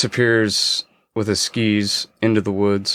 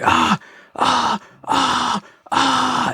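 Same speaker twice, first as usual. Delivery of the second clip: imitated screaming; much slower than usual. Storyteller imitates his professor's screams in a bear attack, high mock screaming. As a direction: mimic distant screams in a high, held voice. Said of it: "I hear this screaming".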